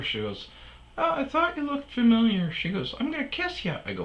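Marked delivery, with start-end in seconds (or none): 1.0-2.5 high, put-on woman's voice; stripper impression. 3.0-3.8 very high put-on female voice; stripper impression.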